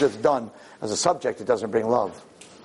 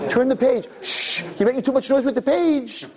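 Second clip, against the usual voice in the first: high-pitched, lightly voiced fussy complaint; mock library scolding; 10 semitones higher than usual. Preacher mimics a library student annoyed at page noise, mock scolding.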